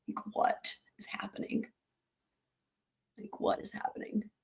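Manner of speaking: whispered disbelief; hushed, stunned murmur; awed whisper. Real, not acted. To her viewers, still stunned, whispering in disbelief.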